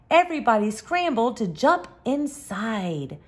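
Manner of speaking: usual speaking voice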